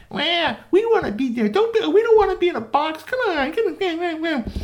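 very high, loud whining; whining Barbies. Acted. Barbies whining to get out of the box, very high. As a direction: whine like a spoiled doll. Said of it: "the Barbies were whining".

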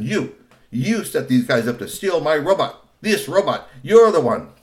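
angry blame. A narrator's reading. Kusumono blaming Kamakura, angry. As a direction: point at the uncle. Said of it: "Kusumono said".

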